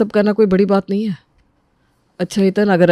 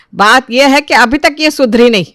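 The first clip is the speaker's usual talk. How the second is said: raised, irritated complaint; exasperated, with higher pitch; angry; 5 semitones higher, 4 dB louder than usual. Acted. Mother-in-law complains to the family about her daughter-in-law, angry. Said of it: "माया देवी बहुत गुस्से में थी".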